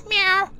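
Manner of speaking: a high, playful "Meow" like a cat. Pretend. Reading the doctor's obituary aloud, a playful cat meow. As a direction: toss off a quick cartoon meow.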